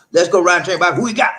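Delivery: loud, high host shout; hype call-out. Real, not acted. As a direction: holler it like a train roll call. Host calls out the next commenter.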